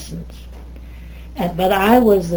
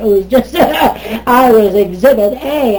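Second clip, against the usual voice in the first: loud, higher voice breaking into real laughter; laughing; 4 semitones higher, 8 dB louder than usual. Real, not acted. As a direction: laugh at yourself while saying it. Laughs at being the curiosity.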